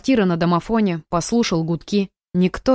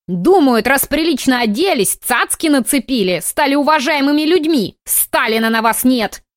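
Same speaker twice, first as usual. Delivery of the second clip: put-on ranting old woman; 6 semitones higher than usual. A narrator's reading. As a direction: grumble like a cranky granny.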